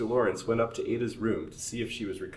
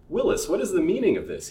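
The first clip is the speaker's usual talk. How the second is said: higher, louder sharp demand; sharply; 11 semitones higher, 7 dB louder than usual. Acted. Master confronts the servant about the open door, sharp. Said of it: "he asked sharply".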